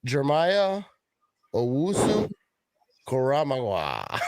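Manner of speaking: Jeremiah Owusu Koramoah read slowly, syllable by syllable, Koramoah stretched out; careful name reading. Shown as a demonstration. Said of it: "I want you to say that name".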